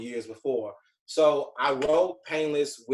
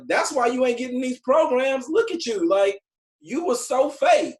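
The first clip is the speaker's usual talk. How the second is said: high, loud rant of an exasperated friend; mock scolding; 10 semitones higher, 6 dB louder than usual. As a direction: tell him off, acting it out. Friend scolds him for being fake.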